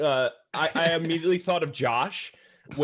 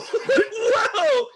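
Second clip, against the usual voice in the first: high, loud laughing whoa; laughing shout; 5 dB louder than usual. Retold or imitated. Retelling the party's reaction, a loud laughing whoa. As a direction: shout it out in delighted shock. Everyone reacts to shocking line.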